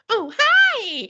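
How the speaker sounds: very high, loud meowed greeting; talking cat. Retold or imitated. Retelling a doorbell video to a co-host, as the talking cat. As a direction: squeal it like a meowing cat. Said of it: "And the cat goes".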